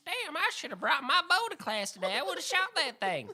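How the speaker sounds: put-on little girl voice. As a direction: play a cocky little schoolgirl. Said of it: "a girl in the classroom who's like".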